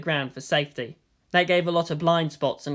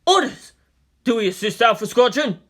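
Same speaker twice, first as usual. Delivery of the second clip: higher, louder demand for orders; urgent demand; 6 semitones higher, 5 dB louder than usual. Acted. Pilot asking command for orders, urgent. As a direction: press for orders now.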